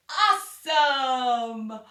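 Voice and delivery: loud, slow, stretched-out Awesome!; big cheering Awesome; excited cheer. Shown as a demonstration. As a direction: throw it to the ceiling with arms up. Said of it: "Throw 'em up in the air and say".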